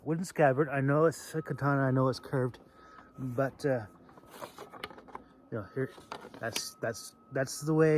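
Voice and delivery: ordinary talk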